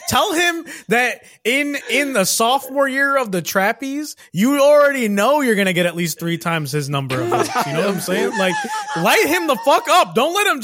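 egging him on, cracking up; laughing hype. Real, not acted. Hyping, laughing.

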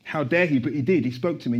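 normal talking voice

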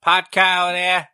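put-on hammy Boston accent calling out; playful Boston accent tease. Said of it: "That's my Boston accent".

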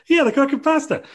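very high, loud mock enthusiasm; excited commentator. Michael Cole backing up his co-commentator, excited. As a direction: echo the hype at the top of your pitch.

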